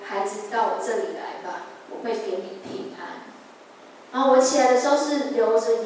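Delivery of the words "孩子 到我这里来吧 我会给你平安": soft, low and gentle, like a comforting father; tender reassurance. Retold or imitated. Jesus comforting the sick girl in her dream, soft and gentle. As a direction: quietly soothe a frightened child.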